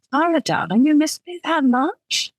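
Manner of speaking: tender question with mild longing; aching. A narrator's reading. Man asking his darling if she missed him, mild ache. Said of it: "a strange ache in his voice".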